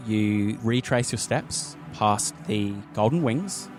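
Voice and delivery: ordinary delivery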